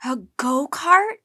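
loud, rising question in amazement; astonished. A narrator's reading. Boy gaping at news of a go-kart, astonished. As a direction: ask it open-mouthed with surprise. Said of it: "with his mouth open".

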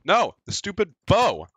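high, loud outburst; exasperated yell. Real, not acted. Gamer shot by a bow, yelling in frustration. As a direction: cry out in game frustration.

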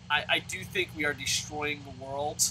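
ordinary delivery

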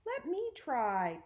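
put-on eager little kid's begging. A narrator's reading. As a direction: plead eagerly, stretching the words slowly. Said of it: "Peter begged".

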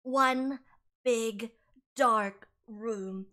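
lightly voiced moody teenager dragging out each word; gloomy Lydia. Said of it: "And Lydia's like".

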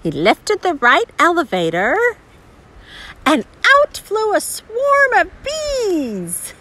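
put-on animated reading to a small child, words stretched; picture-book narration. A narrator's reading. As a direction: read it with exaggerated, swooping intonation.

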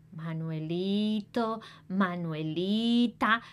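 ordinary delivery

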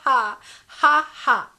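loud, open put-on laugh; forceful mock belly laugh, ending in separate has; demonstrated "ha-ha" guffaw. Shown as a demonstration. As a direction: belt out a big fake ha-ha-ha. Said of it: "Agora você pode rir".

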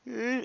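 high-pitched mock whine; short squeal imitating the upset kid. Stuck kid answering his yelling brother, mock whine. Kid's reaction to being yelled at.

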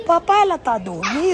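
high, laughing kid voice, voiced by dad; imitating his little son asking, through laughter; child voice. Son asks about Japanese woman.

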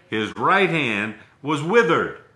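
"right" hit and held, slow read; stress on right. Shown as a demonstration. Reading scripture aloud, slow with "right" stressed. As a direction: weigh "right" then fall. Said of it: "slow emphatic reading".

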